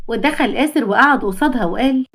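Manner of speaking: ordinary delivery, nothing marked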